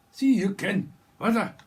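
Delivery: shouted, high mock Scottish; a burst of loud comic Scots; Scottish accent. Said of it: "Someone from Scotland".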